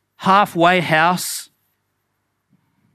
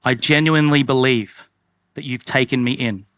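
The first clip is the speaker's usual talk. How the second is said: sincere prayer; 5 semitones lower than usual. Pretend. Model prayer of response.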